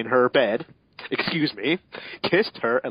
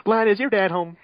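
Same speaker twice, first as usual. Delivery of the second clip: put-on higher, louder girlish voice; Mail voice; 9 semitones higher, 5 dB louder than usual. Mail asks Lan at the door, higher and louder. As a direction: ask it brightly like a cartoon girl.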